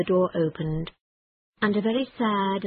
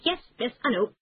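quick brush-off; 6 semitones higher, faster than usual. Acted. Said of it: "said Toad quickly".

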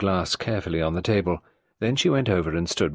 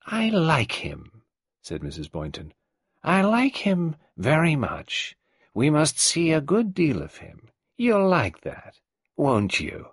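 put-on higher, creaky old matriarch voice; old tyrant mother; 8 semitones higher, slower than usual. Old Mrs Boynton greeting Nadine, in her higher old-woman voice. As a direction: play the domineering old woman.

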